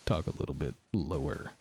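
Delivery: low sick voice. Shown as a demonstration. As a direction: sink the voice low and soft. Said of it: "especially when I do this".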